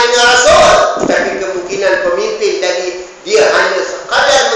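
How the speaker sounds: loud, high, ringing preacher's voice; full-force sermon delivery; forceful preaching. Acted. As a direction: proclaim the verse to the whole hall. Preacher warns about false leaders.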